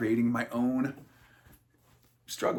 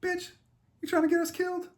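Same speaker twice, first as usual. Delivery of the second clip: squeaky, much higher mock voice snapping at her; mock high scold; faster than usual. Adam snaps at Eve over the apple, in a high mocking squeak. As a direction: play Adam as a shrill, panicky scold.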